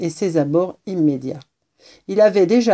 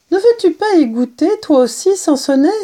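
high, louder mother's question; coaxing mother; 10 semitones higher, 5 dB louder than usual. A narrator's reading. Mother cat urges kitten to taste mouse, coaxing. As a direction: coax in a raised, lifted voice.

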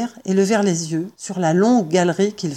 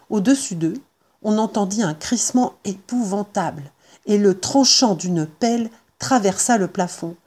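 plain reading voice

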